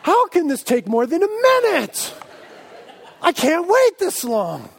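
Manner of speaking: loud, high, hammed-up outrage; disgusted impatience. Impatient pastor yelling at his microwave, mock disgusted outburst. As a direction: wail in mock exasperation, stretching the end. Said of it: "I am so disgusted".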